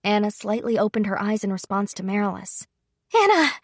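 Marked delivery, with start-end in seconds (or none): 3.1-3.5 sudden high, loud scream of a name. Acted. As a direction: scream for her dying maid. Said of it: "she screamed".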